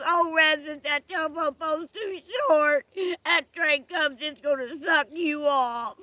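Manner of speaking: high, loud comic alarm; shouted warning. Acted. Liza warning Rastus about the coming train, shouted warning. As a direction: holler a frantic warning across the distance.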